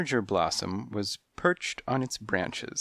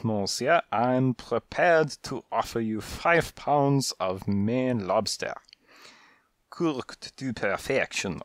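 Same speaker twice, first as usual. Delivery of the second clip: put-on French accent. French cat makes an offer.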